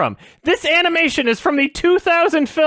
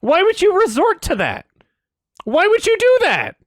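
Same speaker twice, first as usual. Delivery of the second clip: exasperated, rising outrage; real exasperated anger; mad; 3 semitones higher than usual. Real, not acted. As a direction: plead in angry disbelief, pitch climbing. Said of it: "I'm so mad".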